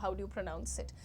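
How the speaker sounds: ordinary talk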